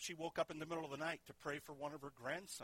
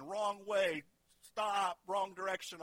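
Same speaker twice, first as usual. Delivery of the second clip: called out signs; 5 semitones higher, 7 dB louder, slower than usual. Retold or imitated. Truck signs read out.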